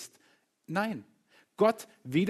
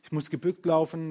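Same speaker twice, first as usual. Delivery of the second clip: usual speaking voice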